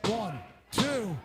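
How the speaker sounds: excited pin count. Real, not acted. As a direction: call the winning pin. The winning pinfall count.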